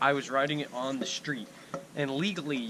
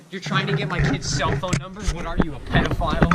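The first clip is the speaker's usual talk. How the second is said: mock angry parent; 3 semitones higher, 7 dB louder than usual. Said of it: "we can be all like".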